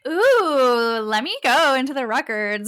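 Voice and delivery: high, excited "ooh" breaking into giggles; playful eagerness. Retold or imitated. Reader meeting someone new wants into the records, playfully eager.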